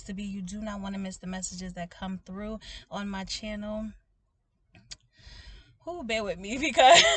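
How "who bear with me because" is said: laughing. Real, not acted. Laughing at her own slip.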